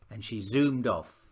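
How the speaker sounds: ordinary delivery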